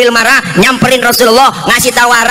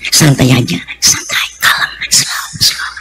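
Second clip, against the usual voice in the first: soft whisper to soothe an angry wife; calming whisper; 7 semitones lower, 3 dB quieter, slower than usual. Acted. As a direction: hush her down gently in a whisper. Prophet calming Aisyah gently.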